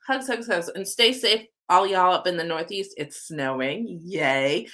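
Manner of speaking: normal talking voice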